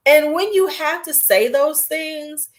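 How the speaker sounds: ordinary talk, nothing marked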